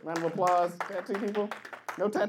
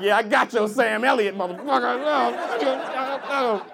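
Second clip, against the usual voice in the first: loud tough-guy retort, last word dragged; mock tough threat; 8 semitones higher, 6 dB louder, slower than usual. Tattooed guy threatening the comic, loud and dragged. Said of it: "You're like".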